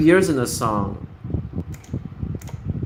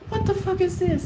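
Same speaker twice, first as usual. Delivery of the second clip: very high, incredulous squeak; exasperated disbelief. Acted. Speaker reacting to parents' baby-talk, exasperated. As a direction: react in high-pitched mock horror.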